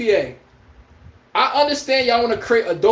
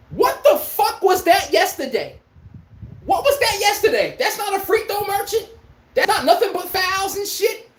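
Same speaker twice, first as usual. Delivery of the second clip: incredulous outrage; 8 semitones higher than usual. Real, not acted. Indignant rant about free throws.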